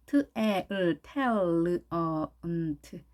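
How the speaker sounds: "트에" slowly split into t, a, l, then uh, n, t; sound-by-sound demo. Shown as a demonstration. Teacher assembling talent for learners, slow sound-by-sound "트에". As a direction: build talent slowly in pieces from "트에" to "트". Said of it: "텔에 강세가 있으니까요".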